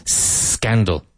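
"Scandal" opening on a drawn-out S; teacher's demo of "Scandal" with a long S; elongated S. Shown as a demonstration. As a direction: stretch the first S before the rest of "Scandal". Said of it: "Elongate the S".

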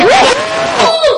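sudden high yelp at a near miss; startled cry of disappointment; surprised shout. Real, not acted. Near miss in live match.

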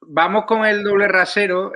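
más alto y agudo, presentando con brío; arranque enérgico. Real, not acted. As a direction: lanza el tema como un anuncio, con fuerza. Presentador lanza tema con fuerza. (presentador que da paso al tema en voz alta)

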